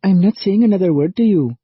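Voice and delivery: high, loud angry snap; snapped. A narrator's reading. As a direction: snap it defiantly. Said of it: "snapped Sal".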